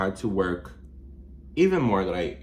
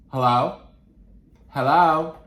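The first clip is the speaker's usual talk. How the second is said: echoing call; 7 semitones higher, 8 dB louder than usual. Real, not acted. Testing echo in empty room.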